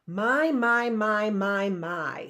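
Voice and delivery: drawn-out mocking my, my, my; a lightly voiced villain sneaking up behind the children; sly villain voice. A narrator's reading. Villain greets the orphans from behind, mocking. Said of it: "said a voice from behind them".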